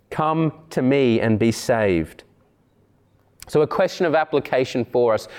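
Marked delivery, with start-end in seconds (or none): 0.1-2.2 slow, lowered voice of a gentle call; a hint of an invitation, low and unhurried; Christ. Preacher voicing the shepherd calling his sheep, slow low call. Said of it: "hear his voice calling by name".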